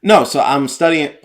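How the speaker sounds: high, loud student quote; mock student voice. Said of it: "quoted student".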